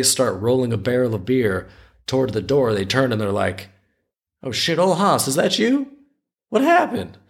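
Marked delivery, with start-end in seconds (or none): 4.4-7.2 high-pitched startled Southern looter; terrible Southern accent. Acted. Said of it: "I got to do a terrible Southern accent".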